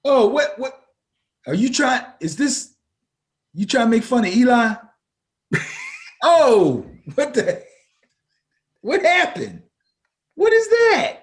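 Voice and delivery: high, loud, disbelieving laughter-filled reaction; loud, high "what happened"; surprised outburst. Real, not acted. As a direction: react in shock to the clip. Reacting to a funny video.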